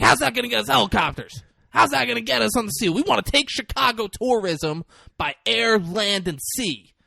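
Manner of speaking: mock excited pitch. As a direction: sell the big plan breathlessly. Excitable brother pitching ideas.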